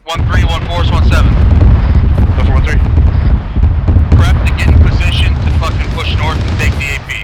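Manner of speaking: shouted orders over gunfire; shouting. Real, not acted. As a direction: bark it out loud, urgent. Squad leader yelling orders in combat.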